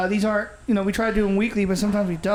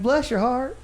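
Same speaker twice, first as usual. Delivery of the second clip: put-on Southern accent; 3 semitones higher, slower than usual. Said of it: "You're like".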